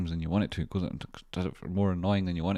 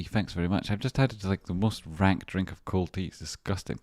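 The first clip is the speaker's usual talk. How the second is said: ordinary talk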